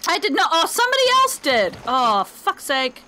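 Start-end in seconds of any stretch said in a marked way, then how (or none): none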